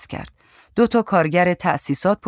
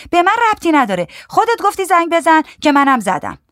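high, loud, defiant retort; defiant woman; 12 semitones higher, 6 dB louder than usual. A narrator's reading. Woman refusing blame for the call, defiant high voice. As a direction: throw the blame back in a high voice.

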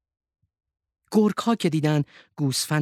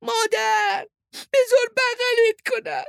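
very high, raised calling voice; Hilo's cry; 3 dB louder, slower than usual. A narrator's reading. Hilo calls out to hug mother, high-pitched shout. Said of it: "هیلو فریاد زد".

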